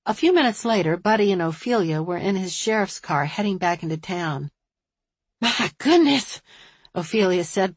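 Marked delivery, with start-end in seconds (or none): 5.4-6.4 high, slow exclaim with a puff; puffing out breath. A narrator's reading. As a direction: blow it out on a breath. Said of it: "puffing out her breath".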